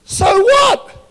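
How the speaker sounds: high, loud "So what?"; shouted so what. Retold or imitated. Preacher retells blasting his neighbour, loud disbelief. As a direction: blurt it out in disbelief. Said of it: "And I'm like".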